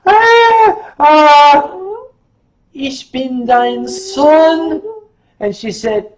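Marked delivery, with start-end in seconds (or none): 0.0-4.8 high, loud, drawn-out mock wail; Chester. Costumed son confesses to his own mother, loud mock wail. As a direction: wail the confession slowly, pitched well up. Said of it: "And Chester said".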